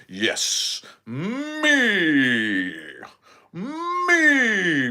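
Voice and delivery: high, loud, gloating cry of "me"; self-centred glee. Pretend. Imagining his Leo-moon self, a high gloating cry. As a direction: crow about yourself like a spoiled star. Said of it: "I would be like".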